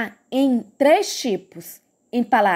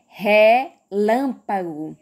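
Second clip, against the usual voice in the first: "relâmpago" very slow, LÂM set apart and stressed; stress on second syllable; 4 semitones lower, much slower than usual. Shown as a demonstration. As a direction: break after "re", then land on LÂM in "relâmpago". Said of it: "eu dei um destaque pro lã". ("relâmpago" bem devagar, destacando o LÂM)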